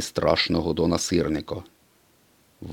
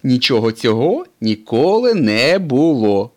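louder, higher reproachful race car; 5 semitones higher, 9 dB louder than usual. A narrator's reading. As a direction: insist it never happened, chiding. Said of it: "з докором сказав блискавка".

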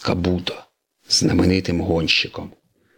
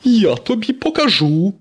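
high, raised-voice threat; 12 semitones higher, 4 dB louder than usual. Acted. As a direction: threaten your rival hotly. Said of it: "пригрозив сирник". (гучна погроза високим голосом)

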